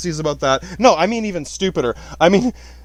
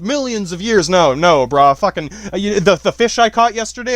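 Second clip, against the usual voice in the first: ordinary talk, nothing marked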